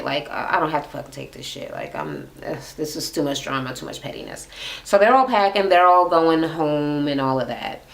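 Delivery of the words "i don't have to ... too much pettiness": muttered exit line. Retold or imitated. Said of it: "she walked away".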